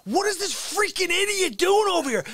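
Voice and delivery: yelling at masked drivers from the car; mocking yell. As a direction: yell at the idiot drivers, sneering. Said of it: "I'm yelling at people".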